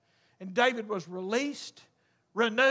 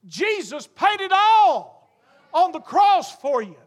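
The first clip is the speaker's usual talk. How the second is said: higher, louder, shouting gospel climax; full force preaching; 6 semitones higher, 6 dB louder than usual. Acted. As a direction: preach it out with rising fire. Preacher builds to altar call.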